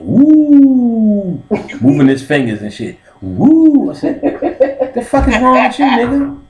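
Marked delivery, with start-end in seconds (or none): 0.0-1.4 long, loud, high mock-excited whoop; imitated gleeful high hoot; eager "ooh". Doctor moving his fingers before exam, high eager hoot. 3.2-3.9 loud, high-pitched mock whoop; imitated gleeful high hoot; excited "woo". Doctor relishing the coming exam, high excited whoop. 4.9-6.4 loud, high-pitched mock outrage; hammed-up high indignant yelp; alarmed challenge. Patient confronting the eager doctor, high alarmed yelp.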